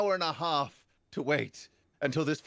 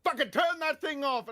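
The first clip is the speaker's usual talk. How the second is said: shouting; 12 semitones higher than usual. Retold or imitated. Audience member shouts at music blasters, high mock yell. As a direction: yell at someone across the room. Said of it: "someone else shouts".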